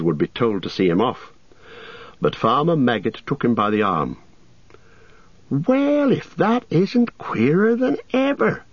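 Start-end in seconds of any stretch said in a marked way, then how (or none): 5.5-8.6 high, slow astonished exclaim. A narrator's reading. Farmer hearing the name Baggins, high astonished exclaim. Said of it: "he exclaimed".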